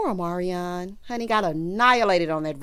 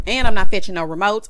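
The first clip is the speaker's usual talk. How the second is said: much louder, firm declaration; emphatic refusal; 14 dB louder than usual. Real, not acted. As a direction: put your foot down hard. Ranting about not obeying parents.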